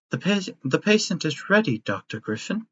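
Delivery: bright, high, sweet robot nurse; kindly robot caretaker. Acted. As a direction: play a doting robot nanny.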